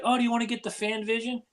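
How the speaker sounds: a hint of his wife asking; a light imitation of his wife; quoting wife. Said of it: "she was like".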